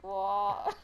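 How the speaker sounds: low, puzzled "what" through a smile; imitated smiling confusion. Imitating herself lost with Birmingham coworkers, smiling confusion. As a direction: smile and pretend to follow. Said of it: "smiling like".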